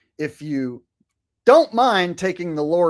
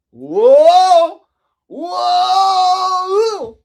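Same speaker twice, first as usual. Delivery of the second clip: hammed-up high shouting whoa; mock alarmed yell; 9 dB louder than usual. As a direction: yelp like your arms are flying off. Acting arms flying away.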